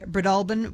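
Breadalbane as bri-DUL-bən; second-syllable emphasis. Shown as a demonstration. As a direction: stress the middle of Breadalbane and almost swallow the last syllable. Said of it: "with the emphasis on the second syllable".

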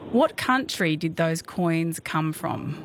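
slow it right down so they catch it. Shown as a demonstration. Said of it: "Can you say that again?".

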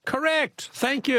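high-pitched triumphant announcement; jubilant. Real, not acted. Radio host to the caller who finally got it, jubilant. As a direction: cheer the winning answer, pitch way up. Host celebrates right answer at last.

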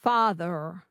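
"Father" echoed with an American final R; American rhotic R. Shown as a demonstration. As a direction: copy the coach's R-coloured "Father". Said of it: "Can you say that?".

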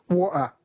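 "water" with the T dropped. Shown as a demonstration. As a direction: say "water" as wa'er, Cockney style. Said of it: "you get to drop letters like water".

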